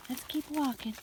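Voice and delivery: high coaxing call to animals; calling to the goats. Real, not acted. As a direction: coax the goats along.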